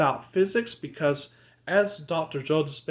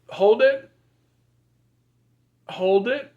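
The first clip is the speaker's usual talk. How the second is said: higher, louder call to hold; firm loud command; 7 semitones higher, 7 dB louder than usual. Acted. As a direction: push them to hold on. Calling out breath hold.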